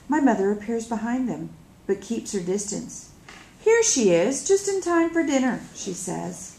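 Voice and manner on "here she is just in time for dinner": higher, louder, falsely cheerful; overly bright welcome, a bit forced; too bright. Acted. Mother greeting late daughter in front of peacekeepers, too brightly. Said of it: "a little too brightly".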